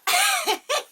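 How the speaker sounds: loud, high cackling giggle; high giggle burst. Real, not acted. Unboxer cannot get over the item, high laughter.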